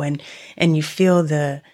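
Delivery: ordinary talk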